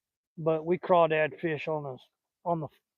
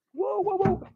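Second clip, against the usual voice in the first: sudden much higher yelp of alarm; startled whoa. Real, not acted. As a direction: catch yourself as the fish slips. Fish nearly slips from his hands.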